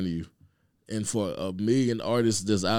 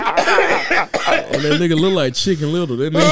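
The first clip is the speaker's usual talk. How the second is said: laughing; 14 semitones higher, 11 dB louder than usual. Real, not acted. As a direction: drag it out while cracking up. Laughing while mocking him.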